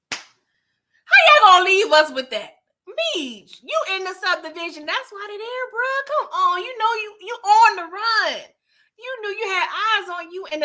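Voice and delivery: loud, high exasperated rant; a listener so worked up the pitch climbs. Real, not acted. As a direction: let it pour out, incredulous. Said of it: "I'm getting all worked up".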